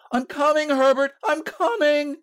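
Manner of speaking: put-on high-pitched old woman calling; frantic calling out. A narrator's reading. Mother calling to her son outside, frantic cry. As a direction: call out high to someone beyond the door. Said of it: "she cried, struggling".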